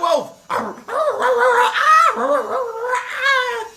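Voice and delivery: panic, high-pitched; high panicked cries. Acted. Pharaoh sees a wolf, high panicked cries.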